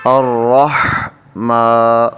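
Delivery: الرحمٰ drawn out slowly with the ha breathed from the throat. Shown as a demonstration. Teacher showing the correct throat sound in الرحمٰ. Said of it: "یہ حلق سے ادا ہو".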